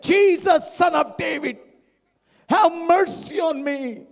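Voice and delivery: preacher acting out a beggar's shout. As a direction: shout it up high to catch his attention. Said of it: "He started shouting".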